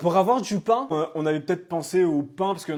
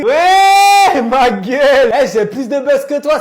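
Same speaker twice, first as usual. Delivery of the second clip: loud, high bragging yell; loud boasting; 7 semitones higher, 10 dB louder, much slower than usual. Real, not acted. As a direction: shout it out proudly. Boasting loudly.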